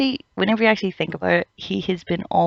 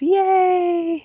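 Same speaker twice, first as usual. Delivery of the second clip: much higher mock cheer; sarcastic yay; 14 semitones higher, 3 dB louder than usual. Mocking yet another Zaraki win with a much higher fake cheer.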